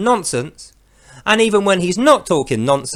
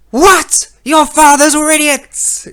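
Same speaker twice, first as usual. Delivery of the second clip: much higher, louder furious cry from a cloud; angry Allah; 10 semitones higher, 7 dB louder, slower than usual. Acted. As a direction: boom it down at the people in fury. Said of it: "I'll be angry".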